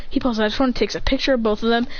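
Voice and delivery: plain reading voice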